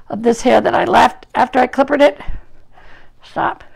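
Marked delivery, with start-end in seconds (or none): none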